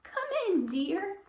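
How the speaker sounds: put-on high, slow sweet old grandmother; a kindly granny welcoming in; grandma voice.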